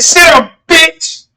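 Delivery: high, mildly forceful retold command; lightly hammed-up angry cop; mock order. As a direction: act out an officer shutting someone up. Acts officer ordering him.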